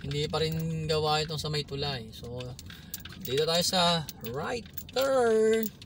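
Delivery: normal talking voice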